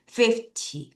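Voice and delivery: fifty with strong FIF, weak ty; FIF-ty, light short ending; stress on the front. Shown as a demonstration. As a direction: hit FIF, let ty drop short. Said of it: "앞을 강하게".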